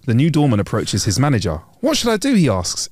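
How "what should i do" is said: mildly anxious, higher-pitched question; nervous. Acted. New doorman asking his manager for help, nervous. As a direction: voice a worried new employee, pitched up.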